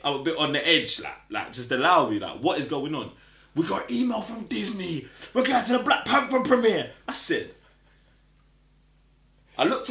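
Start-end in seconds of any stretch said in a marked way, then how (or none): 3.5-6.9 excited announcement. Retold or imitated. Friend announcing news hysterically.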